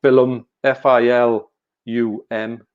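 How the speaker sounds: film stretched slowly into fi-lum; slow, spelled out F I L U M. Shown as a demonstration. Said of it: "a T-shirt with just film".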